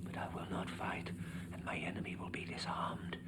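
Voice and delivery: whispering of enemies without fear. Acted. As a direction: murmur it as if someone is listening behind you.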